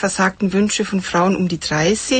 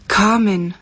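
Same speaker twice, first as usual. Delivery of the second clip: usual speaking voice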